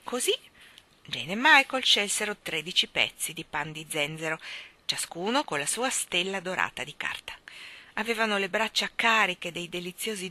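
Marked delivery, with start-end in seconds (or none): none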